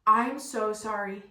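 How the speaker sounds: loud, slow "I'm so sorry"; emphatic apology. Retold or imitated. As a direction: draw out "I'm so sorry", pressing on "I'm". Retells mortified apology.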